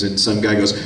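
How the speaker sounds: ordinary delivery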